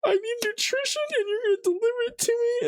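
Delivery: hammed-up sobbing sorry to the onion; mock crying. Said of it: "keep going with the bit".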